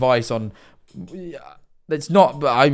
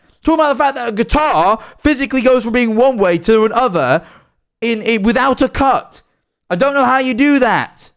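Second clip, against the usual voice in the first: high, raised, exasperated explaining; angry rant; 8 semitones higher than usual. Real, not acted. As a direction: still heated, pushing the point hard. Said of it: "Sorry, I didn't mean to get so angry with you".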